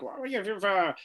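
lightly voiced opposition leader tutting; a hint of Keir Starmer; mock Keir Starmer. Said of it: "Keir Starmer would say".

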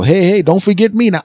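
high, loud call for attention. Retold or imitated. Customer calling out to the arguing cashiers, high and loud. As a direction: call over the fight. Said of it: "And I go".

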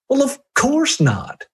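high, slow mock reply from the customer; a customer's high, drawn-out answer. Customer's answer in retold exchange.

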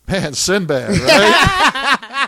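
loud laughing call, pitch jumping up high on right; laughing shout. Retold or imitated. Comedian laughing loudly at the crowd about Sinbad. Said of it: "And then he's just like".

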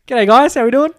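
very high, loud broad Aussie hello; Aussie accent. Retold or imitated. Dialing in to US colleagues, loud hammed-up Aussie. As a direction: belt out an exaggerated Australian greeting. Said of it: "they love the Aussie accent".

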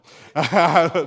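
big loud burst of laughter; hearty laugh at his joke. Real, not acted. Laughs at his own story.

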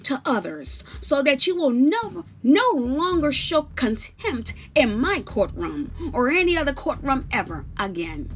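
loud, high authoritative judge; commanding judge. Acted. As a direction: instruct the court with authority. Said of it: "says the following".

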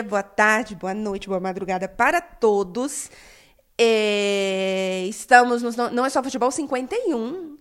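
normal talking voice

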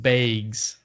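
"bags" pronounced "baygs"; Minnesotan vowel in bags. Retold or imitated. Recalling the manager's word, "bags" said as "baygs". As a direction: give "bags" a long AY vowel. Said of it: "she said".

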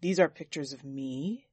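low disbelief, rising at the end; shocked question. A narrator's reading. Master seeing his own photographs, low and shocked. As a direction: let it slip out low, then lift. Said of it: "a question slipped out of him in his shock".

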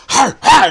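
high, loud mock dog attack barks; imitated dog snarl. Telling how the dog went at him, high loud mock snarls.

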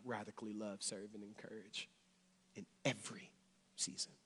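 very soft, low sermon delivery; low and hushed, with pauses between phrases; hushed preaching. Acted. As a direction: let the sermon drop to a near whisper.

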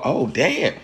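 high, slow, half-laughing amazement at the book; shocked reaction. Retold or imitated. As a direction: act out a student's scandalized disbelief while reading. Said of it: "And you go".

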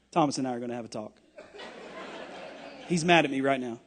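fast, low, laughing aside. Real, not acted. Preacher joking that Thomas is mad at him, laughing. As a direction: toss it off laughing.

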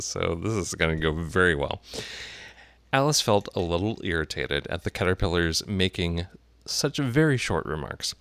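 ordinary delivery, nothing marked

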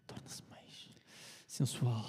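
breathy purring seductress. Shown as a demonstration. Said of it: "dito mais baixo". (sussurro sedutor)

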